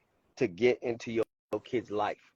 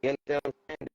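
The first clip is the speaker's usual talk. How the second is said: choppy cutting-out audio; breaking up; 4 semitones higher than usual. Real, not acted. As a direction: signal dropping in and out. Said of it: "breaking up".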